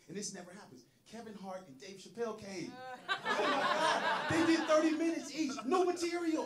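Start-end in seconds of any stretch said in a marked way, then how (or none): 3.2-6.5 much higher and louder, laughing through it; real laughing excitement; excited shout. Real, not acted. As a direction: rave about the show at full volume. Raving about Hart and Chappelle show.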